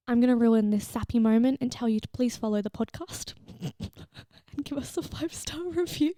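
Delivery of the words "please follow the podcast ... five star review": breaking into laughter mid-request; laughing plea. Real, not acted. Podcaster spoiling her sappy moment with a plug, laughing. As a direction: let a giggle swallow the words.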